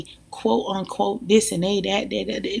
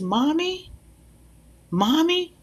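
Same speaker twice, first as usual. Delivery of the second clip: put-on higher, rising little-girl call of Mommy; child calling; 9 semitones higher, slower than usual. Little girl in the store calling for her mother, high and rising. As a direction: call out like a lost small child.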